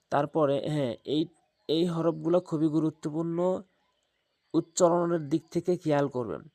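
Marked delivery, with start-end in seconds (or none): none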